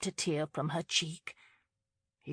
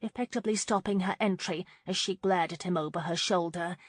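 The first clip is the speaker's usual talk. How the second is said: ordinary delivery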